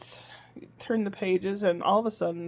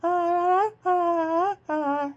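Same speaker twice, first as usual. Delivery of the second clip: put-on much higher sung baby "ah"s; imitating baby singing; 10 semitones higher, 3 dB louder, much slower than usual. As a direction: mimic the baby trying to sing. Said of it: "and he does".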